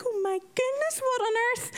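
high startled exclamation; shocked. Retold or imitated. Shocked at sick patient.

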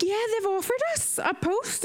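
high-pitched excited retelling; imitated excitement. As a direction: imitate a friend squealing good news. Retold friend squealing the news.

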